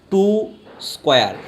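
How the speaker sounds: "ଟୁ স্কয়ার" in two separate words, pause between; pronunciation demo. Shown as a demonstration. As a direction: pronounce "ଟୁ", pause, then a firm falling "স্কয়ার". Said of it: "উচ্চারণগুলো কীভাবে করবে".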